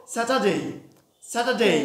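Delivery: স্যাটারডে in a British accent, no r; teacher contrasting the British স্যাটারডে, r silent; British pronunciation. Shown as a demonstration. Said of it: "ব্রিটিশরা কি বলবো?".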